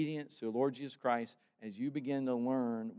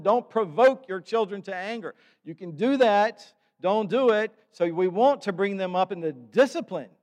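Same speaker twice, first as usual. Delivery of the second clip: loud, higher preaching exhortation; full-force preaching; 8 semitones higher, 10 dB louder than usual. Acted. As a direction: drive the warning home from the pulpit.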